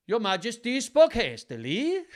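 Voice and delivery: high, loud mock Icelandic accent; high, loud, hammed-up Icelandic accent. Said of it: "he was Icelandic".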